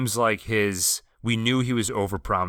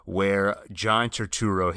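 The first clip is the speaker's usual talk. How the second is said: ordinary talk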